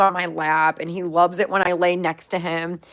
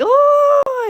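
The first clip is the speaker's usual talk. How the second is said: sudden very high squealed oh; upset pig squeal; 6 dB louder than usual. Retold or imitated. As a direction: squeak it like a hurt little pig. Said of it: "he immediately is like".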